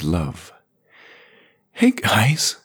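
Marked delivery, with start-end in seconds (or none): 1.7-2.6 croaked, higher; raspy whisper. A narrator's reading. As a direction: croak it out weakly. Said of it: "she croaked".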